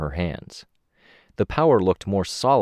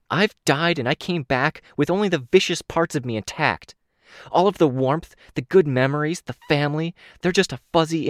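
raised, cold threat to the room; a killer warning her team; menacing Ashley; 7 semitones higher, 3 dB louder than usual. A narrator's reading. Ashley to her shaken teammates, a cold higher threat.

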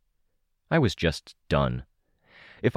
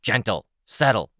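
firm, slightly raised command; firm order; 5 semitones higher, 3 dB louder than usual. Acted. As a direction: settle the dogs with a firm word. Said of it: "Rachel ordered".

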